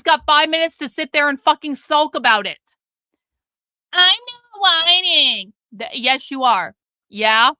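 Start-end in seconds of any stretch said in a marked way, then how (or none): 3.9-5.5 slow, higher put-on whiny voice; mocking whine. Streamer mocks the timed-out chatter's protest. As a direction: drag it out like a pouting child.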